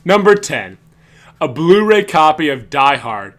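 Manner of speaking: loud, slow countdown host voice; hammed-up host voice reading a top ten list; announcer countdown. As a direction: belt it out like a radio countdown. Quoting Christmas special bit.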